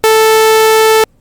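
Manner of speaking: high squealing party blower imitation; party blower noise. Shown as a demonstration. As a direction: toot like a party horn. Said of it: "was supposed to sound like a celebratory party blower".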